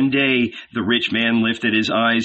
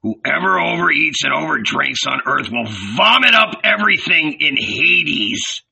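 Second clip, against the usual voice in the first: relishing taunt, climbing higher; gloating condemnation; 5 semitones higher than usual. A narrator's reading. Judas jeers at gluttons bound for Hades, gloating.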